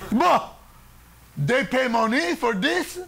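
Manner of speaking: put-on Italian accent, scoff at paying to scream. Mister Paretta scoffing to Charlie, Italian accent. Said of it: "Mister Paretta reply".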